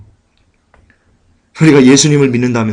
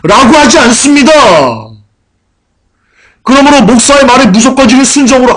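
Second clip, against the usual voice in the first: loud, high-pitched sermon delivery; raised-voice pulpit exhortation; forceful preaching; 9 semitones higher, 4 dB louder than usual. Real, not acted.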